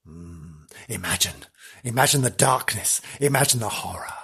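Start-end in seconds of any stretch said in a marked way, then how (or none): none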